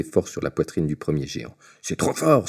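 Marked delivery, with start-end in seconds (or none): 1.8-2.5 higher, louder angry cry; a giant's burst of rage; angry giant outburst. A narrator's reading. Giant struck by a stone cries out furiously. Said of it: "s'écria-t-il".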